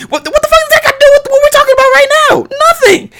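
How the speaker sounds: high, fast, exasperated outburst; fed-up squeal of disbelief; voice shoots way up. Real, not acted. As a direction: let your voice jump up high in frustration. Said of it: "my voice just went up like five, fifteen thousand octanes".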